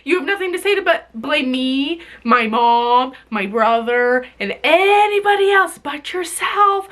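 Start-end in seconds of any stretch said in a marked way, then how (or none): none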